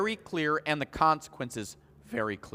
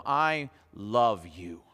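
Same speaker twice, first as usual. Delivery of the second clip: tender; 7 semitones lower, much slower than usual. Retold or imitated. Tender to spanked child.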